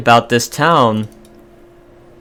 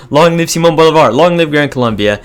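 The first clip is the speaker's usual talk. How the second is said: louder, higher rallying cheer; mock proclamation; 6 semitones higher, 8 dB louder than usual. Gamer hails Bolivar to viewers, rallying mock proclamation. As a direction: hail the hero like a crowd herald.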